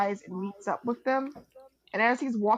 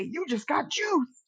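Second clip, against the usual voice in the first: OJ taunt; 4 semitones higher, slower than usual. Retold or imitated. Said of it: "And then he was like".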